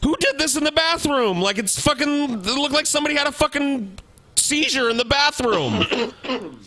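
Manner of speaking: high, put-on alarmed voice, slowing at the end; mock alarm. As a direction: fake shock over the wrecked bathroom. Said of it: "And I was like".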